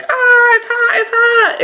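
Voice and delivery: high squealed imitation of being burned; mock yelping. Luffy and Yamato get flown away by explosion, mock yelping. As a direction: yelp it in a very high, comic panic.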